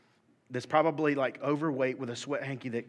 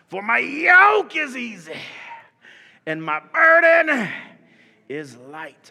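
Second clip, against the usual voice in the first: preaching shout, dropping; 9 semitones higher, 11 dB louder, slower than usual. Shown as a demonstration. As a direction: shout it, then drop to a hush. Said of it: "repeat after me".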